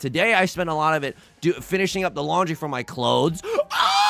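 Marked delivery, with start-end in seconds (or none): 3.7-4.1 sharp gasp. Real, not acted. Sees a big winning hand.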